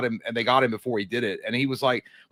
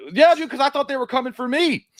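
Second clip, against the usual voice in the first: high, loud comic alarm; hammed-up paranoid outburst; mock panic; 12 semitones higher, 5 dB louder than usual. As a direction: blurt it out high and urgent. Acted panic about the police.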